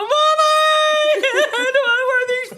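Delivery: put-on high, loud whiny kid wail; whining child. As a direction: wail like a bratty little boy. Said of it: "And he's going".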